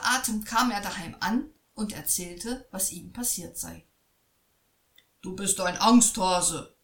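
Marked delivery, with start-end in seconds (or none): 5.2-6.8 loud scolding father; father scolds his frightened son, louder. A narrator's reading. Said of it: "schimpfte der Vater".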